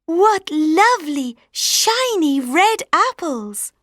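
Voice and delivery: put-on high, slow, delighted girl voice; lightly voiced charmed young girl. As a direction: admire the apples sweetly.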